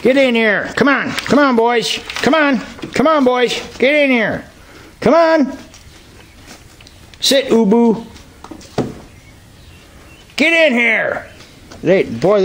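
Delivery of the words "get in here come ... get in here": high, repeated coaxing calls; calling the goats in. Real, not acted. Farmer herding goats into the new pen, high coaxing calls. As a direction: coax the goats into the pen, over and over.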